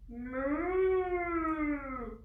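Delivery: put-on slow drawn-out moo for kids; playful cow moo. Puppet cow sound.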